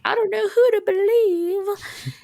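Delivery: put-on high, slow, dithering kid voice; high slow voice of confused children. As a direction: squeak it out, torn between both sides. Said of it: "And they're like".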